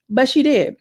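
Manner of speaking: ordinary talk, nothing marked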